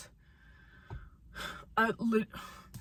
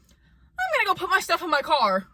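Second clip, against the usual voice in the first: high-pitched mimicry of a coworker; mocking coworker; 7 semitones higher than usual. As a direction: put on an airy, oblivious excuse voice. Mimics coworker excusing herself.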